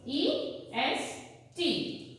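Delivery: drawn-out letter spelling for the class; each letter slow and separate. Shown as a demonstration. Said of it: "Suffix E S T".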